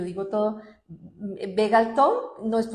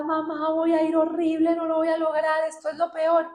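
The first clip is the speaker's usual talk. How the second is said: imitating desperate crying; 7 semitones higher, 4 dB louder than usual. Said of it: "lloraba así desesperada".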